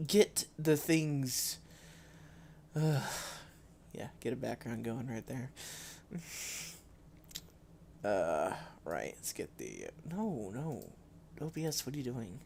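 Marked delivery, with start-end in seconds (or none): none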